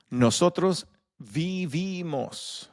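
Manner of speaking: Nosotros vivimos drawn out syllable by syllable; slow model pronunciation. Shown as a demonstration. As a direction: spell it out aloud for the class. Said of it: "keep plugging and playing".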